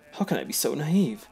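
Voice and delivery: usual speaking voice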